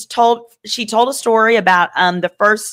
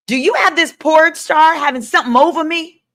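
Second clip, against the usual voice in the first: raised higher, hammed-up suspicious [name]; mock [name] voice; 8 semitones higher than usual. Retelling trial testimony, a higher hammed-up [name]. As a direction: play the paranoid mogul, pitched up. Mocking [name]'s quoted question.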